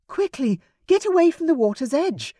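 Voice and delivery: high, urgent hurry-up; urging. A narrator's reading. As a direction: rush him away from danger. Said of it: "Rosamond urged".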